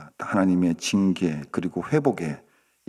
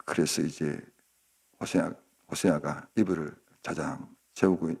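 usual speaking voice

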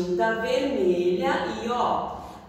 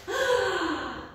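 high gasp of wonder; amazed gasp; 9 semitones higher than usual. Pretend. Reacting to the magic.

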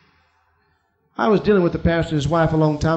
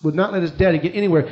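usual speaking voice

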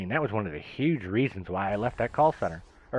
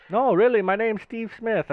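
mock call center agent; 11 semitones higher, 5 dB louder than usual. Agent insists fake name is real.

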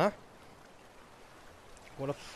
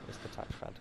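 whispered to himself under his breath; whispered realisation; 15 dB quieter than usual. Real, not acted.

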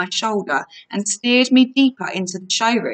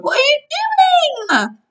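high-pitched outburst, dropping at the end; indignant yell; 4 dB louder than usual. A narrator's reading. Annabeth to friend flattering their captor, indignant yell. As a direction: yell the question, starting very high. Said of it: "Annabeth yelled".

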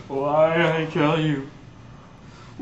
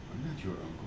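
much softer, lower denial; cold denial; 11 semitones lower, 17 dB quieter, much faster than usual. Acted. Villain denying he's the uncle, low and hushed.

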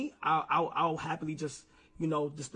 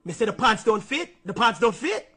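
slipping into Jamaican patois, louder on the pants line; heated street Jamaican patois; 4 dB louder than usual. Real, not acted. Young man slips into patois telling manager about the beef, Jamaican patois. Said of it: "My patois just came out".

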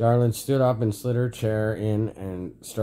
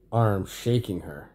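plain reading voice

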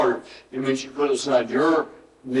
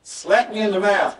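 preaching emphasis; 8 semitones higher than usual. Real, not acted. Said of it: "Get ready".

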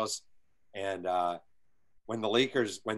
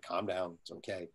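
softer hushed soothing, trailing down; calming; 3 semitones lower, 10 dB quieter than usual. Retold or imitated. General manager soothing the panicking coach, softly. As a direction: settle him like a nervous friend.

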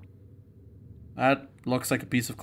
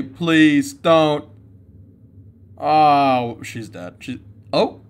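usual speaking voice

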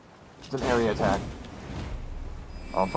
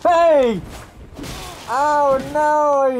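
alarmed protest; 7 semitones higher, much slower than usual. Real, not acted. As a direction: yell out as the attack lands. Cries out as boss attacks.

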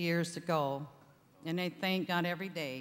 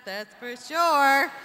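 slow, louder, laughing through it; 6 semitones higher, 9 dB louder, slower than usual. Real, not acted. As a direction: joke while laughing. Laughing joke about the hosts.

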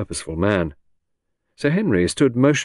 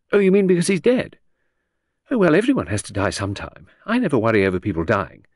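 higher, airy society lady; breezy chatter that floats from topic to topic; Lucy, lady of the house; 5 semitones higher, faster than usual. Acted. Chatting to her husband in the garden, airy high lady.

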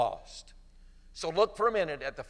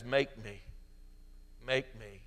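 softer, lower begging; humbled plea; 5 semitones lower, 7 dB quieter than usual. Retold or imitated. Son returning humbled to his father, softer and lower. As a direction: ask meekly this time. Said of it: "the second time that he goes back".

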